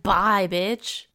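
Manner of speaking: loud, brash mock send-off; mock dismissive farewell. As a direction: toss it out loudly as a callous goodbye. Mimicking mom dumping her son.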